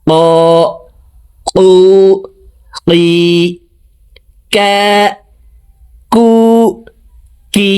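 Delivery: ক, কু, কি made a bit forward of the tongue root; kaf from the tongue root. Shown as a demonstration. Teacher demonstrating kaf after explaining it. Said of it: "দেখুন".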